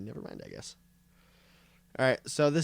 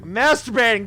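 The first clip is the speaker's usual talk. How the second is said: louder, snapping back; raised annoyed shout; 6 semitones higher, 6 dB louder than usual. Acted. Repeated answer, shouted louder.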